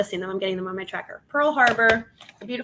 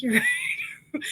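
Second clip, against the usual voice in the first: laughing. Real, not acted. Laughing at viewer comment.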